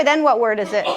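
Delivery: ordinary talk, nothing marked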